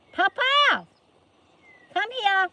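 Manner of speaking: shouted high and loud across the yard; calling out. Real, not acted. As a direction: call out to Papa from far off.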